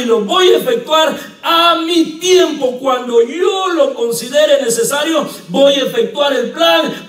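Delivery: high, loud pulpit shout; full-force preaching. Acted. Pastor shouting God's promise to the church, full-force preaching. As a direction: thunder it out to the congregation.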